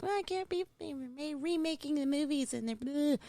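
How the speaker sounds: high, soft mocking complainer; whiny complainer voice. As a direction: whine it like a fussy fan. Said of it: "people are like".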